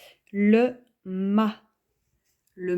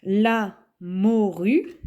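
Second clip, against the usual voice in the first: "La morue" drawn out slowly and louder; slow dictation; 5 dB louder, much slower than usual. Shown as a demonstration. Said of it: "dictating then explaining the word".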